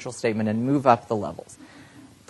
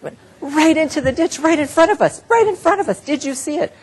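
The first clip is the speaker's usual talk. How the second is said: much higher, urgent and alarmed; anxiously pointing out the crash; alarmed passenger; 5 dB louder than usual. Pretend. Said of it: "level two".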